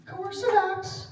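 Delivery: very high, slow, drawn-out call; high-pitched roll call. Pretend. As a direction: call for the expert in a tiny high voice, stretched out. Roll-call joke seeking the expert.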